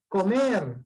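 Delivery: Comer with the final syllable leaned on; stress on last syllable. Shown as a demonstration. Teacher giving an aguda example, stress on MER in Comer. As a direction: stretch the stressed end of Comer. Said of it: "fuerza de voz en la última".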